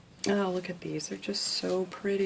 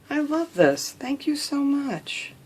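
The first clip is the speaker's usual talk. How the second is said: higher and louder, gushing thanks; thrilled thanks; 6 semitones higher, 5 dB louder than usual. Real, not acted. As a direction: burst out with delight at the gift. Thrilled thanks on opening a gift.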